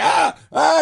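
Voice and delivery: sharp high yelp, hammed up; imitating a startled, disgusted yelp; mock yelp of disgust. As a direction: recoil with a loud high yelp. Retelling hero recoiling from ghost.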